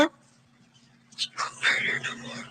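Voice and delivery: breathy whisper, almost no voice. Real, not acted. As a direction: whisper the words to yourself. Mother repeats it, whispered.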